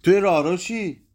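loud, high accusing shout; the wife shouting at her husband, high and loud. A narrator's reading. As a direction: hurl the question at him. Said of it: "زن داد زد".

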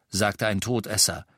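plain reading voice

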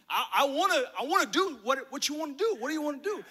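high, excited, insistent pleading; eager coaxing. Acted. Dad urging his son to pick anything, high and eager. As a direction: push the kid eagerly toward something bigger. Father urging a bigger wish.

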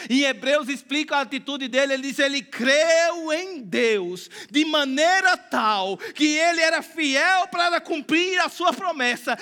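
normal talking voice

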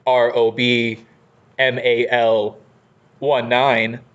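robotic spelling-out, one group at a time. Pretend. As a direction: spell it evenly like a robot, for clarity. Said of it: "I just put on my robot voice".

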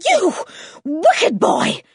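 outraged and suddenly out of breath; panting rage. A narrator's reading. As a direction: gasp out the scolding in a rage. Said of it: "panted Missus Penruthlin, sounding suddenly out of breath".

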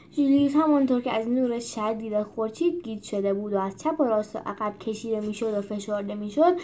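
plain reading voice

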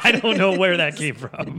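words breaking with laughs; laughing. Real, not acted. Laughing at the slip.

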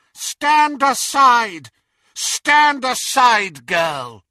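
slow, loud, high shout; menacing command. Acted. Intruder losing patience with a pleading mother, commanding. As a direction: roar the order, dragging it out.

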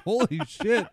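mock shock, high pitched. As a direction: imitate a stunned gasp of words. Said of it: "Just like".

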